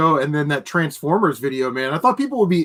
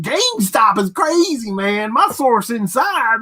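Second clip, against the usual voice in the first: higher, mocking YouTuber drama voice; Camelot impression; 6 semitones higher than usual. Mocking Camelot's video intro, high hammed-up host voice. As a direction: ham up the hot-topic host voice. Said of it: "Camelot uses that voice like".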